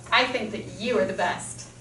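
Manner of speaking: weary sigh. Shown as a demonstration. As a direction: say it like you're tired of them. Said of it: "do you feel how that changes".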